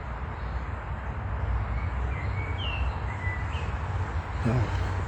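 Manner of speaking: long breathy exhale of exhaustion; long weary sigh. Real, not acted. After ranting about xenophobia, a long weary sigh. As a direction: let out a deep tired sigh.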